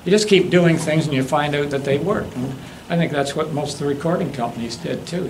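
ordinary delivery

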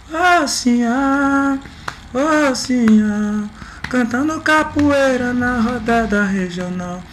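slow high falsetto chorus; man doing a woman's voice. Shown as a demonstration. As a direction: sing the women's chorus up high, slowly. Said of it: "eu tô fazendo uma voz de mulher".

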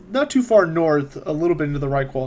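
ordinary talk, nothing marked

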